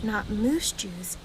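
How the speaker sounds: ordinary delivery, nothing marked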